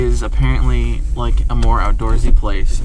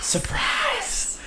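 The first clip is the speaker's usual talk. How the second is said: excited shout; 9 dB quieter than usual. Real, not acted. Announcing a surprise trip.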